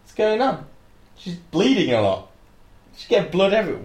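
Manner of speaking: high-pitched clueless guy voice; baffled boyfriend. Acted. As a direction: play a bewildered man, panicking slightly. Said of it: "imagine some guy just like".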